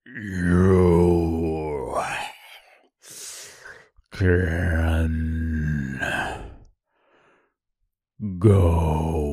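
low, slow brute voice; deep gurgling voice. Acted. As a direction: growl it out like a monster. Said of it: "in a deep, gurgling voice".

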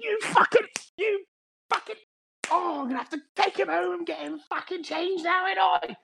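very high, screechy Cockney mother; shrieked mock-Cockney scolding; Cockney mum. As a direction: do a hammed-up Cockney mum losing it. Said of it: "she sounds like Cockney a little bit".

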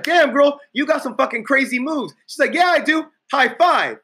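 lifted, excited compliments; high hyped flattery. Retold or imitated. Instructor flatters dancing girl into high five, excited. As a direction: pump her up with high energy.

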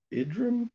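IDRIM said with a short "ih" opening and high pitch; trying a pronunciation. Shown as a demonstration. Recapper guessing at a hard name, questioning pronunciation. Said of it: "Really hard to say".

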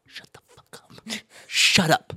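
hissed angry shush; harsh hushing. Retold or imitated. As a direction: hiss it to silence them. Scolding the kitchen crowd.

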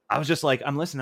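normal talking voice

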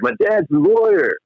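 high, slow smug claim; mock Barry. Barry telling the guy his dad's a lawyer, high mock. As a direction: brag it slowly.

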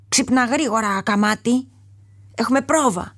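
a trainer barking orders at the lion; loud trainer's voice. A narrator's reading. Circus trainer waking the lion for rehearsal, gruff call. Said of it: "άκουσε μια δυνατή φωνή".

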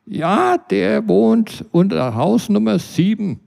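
a nosy neighbour mocking him; neighbour Britta. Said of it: "Ihre Antwort".

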